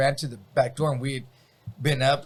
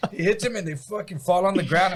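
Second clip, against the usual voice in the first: ordinary talk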